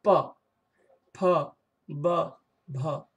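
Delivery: प फ ब भ said one by one with the lips pressed together; labial series. Shown as a demonstration. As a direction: close both lips for प फ ब भ. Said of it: "जैसे देखिए". (ओष्ठ्य वर्ण प फ ब भ होंठ सटाकर)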